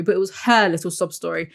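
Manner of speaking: usual speaking voice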